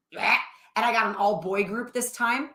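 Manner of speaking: ordinary delivery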